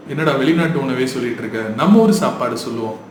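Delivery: ordinary talk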